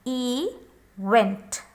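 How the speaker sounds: event split in two, long E first. Shown as a demonstration. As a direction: draw out the E in event, then vent. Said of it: "pronouncing this E as a long E".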